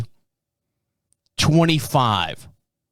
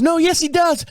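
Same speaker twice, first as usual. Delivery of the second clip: much higher-pitched mimicked protest; insistent contradiction; 12 semitones higher than usual. Panhandler argues back at the driver, high and insistent. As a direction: imitate him arguing back, pitched way up.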